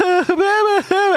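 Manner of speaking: high, loud burst of laughter. Real, not acted. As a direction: cackle at the chaos. Laughing at panicking buyers.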